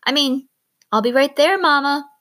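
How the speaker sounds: added quickly. A narrator's reading. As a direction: backpedal fast and bright to Mama. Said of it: "then added quickly".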